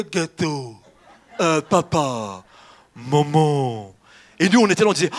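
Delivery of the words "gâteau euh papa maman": loud, slow, clumsy imitation of a toddler; toddler's first words. Preacher imitating a small child's first words, clumsy baby talk. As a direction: drag out each word like a baby learning. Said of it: "Et quand vous parliez".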